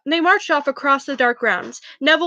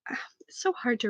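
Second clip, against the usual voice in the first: whispered aside; 3 semitones lower, 12 dB quieter than usual. Real, not acted. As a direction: breathe it out, tired.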